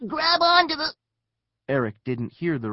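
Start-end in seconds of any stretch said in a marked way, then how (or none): 0.1-0.9 high, loud, cut-off shout; desperate shout. A narrator's reading. Neil to falling Eric, desperate high shout. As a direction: shout to save him, breaking off mid-phrase. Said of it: "Neil shouted".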